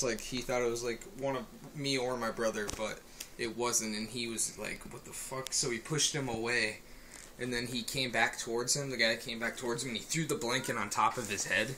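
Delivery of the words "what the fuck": whispered, breathless curse; hushed curse. Retold or imitated. Waking to a stranger by the bed, a whispered curse. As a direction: hiss it under your breath in shock. Brother sees intruder, hushed.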